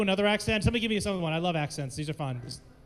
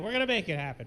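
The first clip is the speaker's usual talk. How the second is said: ordinary talk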